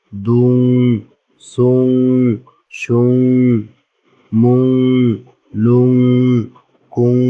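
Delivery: syllables like "মুং" drawn out on the closing nasal; ghunnah drill. Shown as a demonstration. Teacher leading learners through the chart, nasal hum held on "হুং". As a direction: sustain a hum on each ending, as in "লুং". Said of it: "গুণা করতে হবে".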